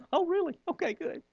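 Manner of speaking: high, hushed sarcastic "oh, really?"; mock doubtful worker. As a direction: humor him, high and quiet.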